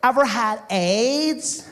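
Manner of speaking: high, slow mock-innocent question; California surfer babe. Surfer babe questions African visitor, high slow drawl. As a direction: stretch it out like a clueless beach babe. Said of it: "and she goes".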